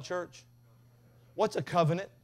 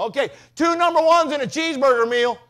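yelling at the drive-thru; 12 semitones higher, 13 dB louder than usual. Retold or imitated. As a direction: bellow the order at the drive-thru speaker. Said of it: "Dad's like".

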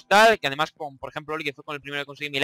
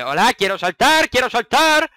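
whiny demand; 5 semitones higher, 7 dB louder, slower than usual. Real, not acted. Pleading with the game.

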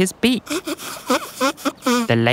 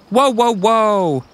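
slow, drawn-out, higher-pitched whoa; storybook ladybug booming in; 8 semitones higher, 4 dB louder, slower than usual. A narrator's reading. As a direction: call a halt in a big, slow voice. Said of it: "a deep velvety voice boomed".

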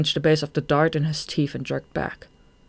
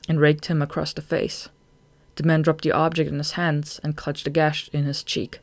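ordinary delivery

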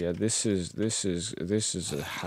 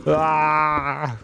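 mock ecstatic cry; 6 semitones higher, 5 dB louder than usual.

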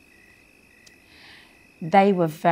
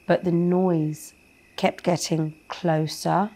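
plain reading voice

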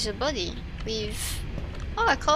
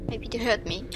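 ordinary talk, nothing marked

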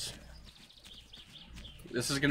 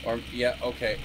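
usual speaking voice

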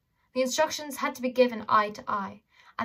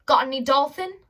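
sarcastic; 4 semitones higher, 7 dB louder than usual. Acted. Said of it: "Holly asked sarcastically".

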